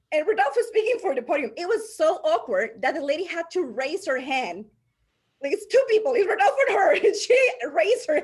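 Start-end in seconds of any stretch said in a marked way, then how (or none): 5.4-8.2 telling it through real laughter, high and loud; amused, raised voice breaking into laughs; laughing. Real, not acted. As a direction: laugh while telling the awkward story. Tells funny awkward story.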